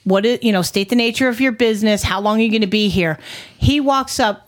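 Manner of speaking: ordinary delivery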